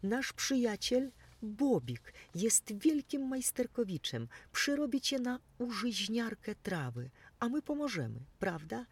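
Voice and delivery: high, eager chatter; delighted mouse. Acted. The mouse telling the mower about Bobik, high and eager. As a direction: bubble over with the good news.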